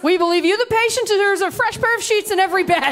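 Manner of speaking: mock cheery nurse selling fresh sheets; chirpy mock nurse. Nurse's chirpy hospitality line.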